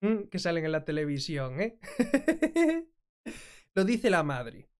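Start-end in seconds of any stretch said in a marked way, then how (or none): 1.8-2.8 short burst of laughter; real laugh. Real, not acted. Commentator laughs at the bloopers on TV, real laugh.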